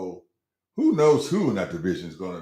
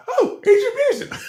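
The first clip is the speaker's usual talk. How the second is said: very high, loud falsetto, hammed-up; high-pitched voice; 6 dB louder, faster than usual. Club worker re-enacting meeting a star athlete, hammed-up high-pitched voice. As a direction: squeal it in an exaggerated high-pitched voice. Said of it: "I must have my high-pitched voice going".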